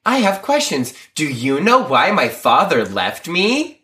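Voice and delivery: put-on high kids-show voice, slowing down; Dora the Explorer. Retelling a TV spoof, Dora parody voice. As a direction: ask it with mock-preschool deliberateness. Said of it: "and she's like".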